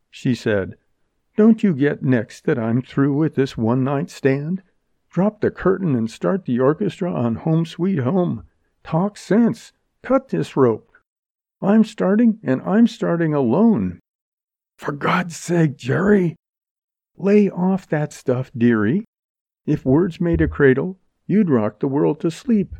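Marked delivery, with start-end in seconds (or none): 1.4-14.0 higher-pitched, scornful slangy woman; impatient heroine. Acted. Jerry demands to be let out, with impatient scorn. 14.8-16.4 higher, drawn-out appeal; exasperated plea. Acted. Montgomery pleads with raving Jerry, exasperated. 17.2-22.8 higher, wisecracking dismissal; scornful heroine. Acted. Jerry cuts short the man's pleading, scornfully.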